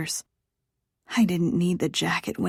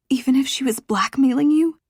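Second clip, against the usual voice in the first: much higher and louder sceptical jab; sharp challenge; 7 semitones higher, 7 dB louder than usual. Acted. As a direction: fire it back in disbelief. She challenges his excuse.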